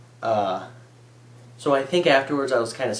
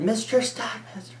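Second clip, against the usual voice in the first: high, strained call of the name; strained cry; 12 semitones higher than usual. Retold or imitated. Boy finally calling the teacher, high strained. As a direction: finally blurt the name out in shock. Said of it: "I couldn't get the words out".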